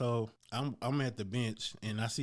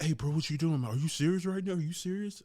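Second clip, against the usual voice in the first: higher, mock-indignant bro challenge; imitating angry gym guy; 5 semitones higher than usual. Gym guy challenging him over the rack, mock indignant. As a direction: act out the guy getting in his face, raised pitch.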